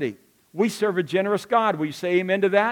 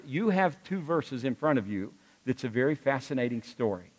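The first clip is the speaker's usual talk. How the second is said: ordinary talk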